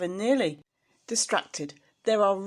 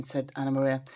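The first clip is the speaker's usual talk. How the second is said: ordinary delivery, nothing marked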